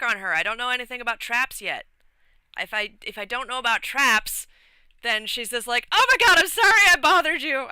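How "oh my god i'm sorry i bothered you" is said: high, fast, fussy mock apology; mock NPC voice. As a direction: squeak it out like a flustered nun. Mocking the NPC brushing him off.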